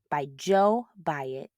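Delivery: ordinary delivery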